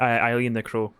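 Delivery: ordinary talk, nothing marked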